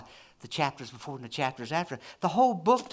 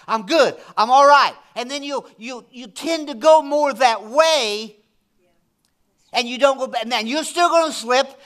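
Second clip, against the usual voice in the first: raised, emphatic preacher voice; impassioned preaching; 7 semitones higher, 8 dB louder than usual. Real, not acted. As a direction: preach it hard, punching key words. Preaches with force.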